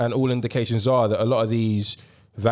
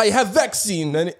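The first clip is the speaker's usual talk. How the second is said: much higher, hammed-up Russian accent; 9 semitones higher than usual. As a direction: boast like a cartoon Russian leader. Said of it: "your best Russian accent".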